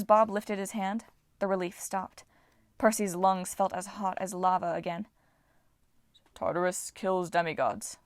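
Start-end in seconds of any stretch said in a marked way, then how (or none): 6.3-8.0 Titan voice. A narrator's reading. Voices Bob.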